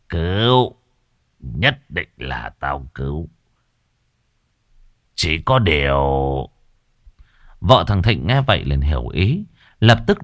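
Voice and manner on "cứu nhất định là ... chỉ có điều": lower, slow sly hint; low sly sorcerer voice; sly old master. Acted. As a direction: drag it out to hint at money. Master hints at payment.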